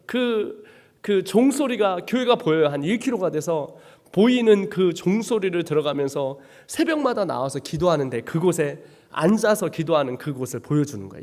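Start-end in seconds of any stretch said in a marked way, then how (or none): none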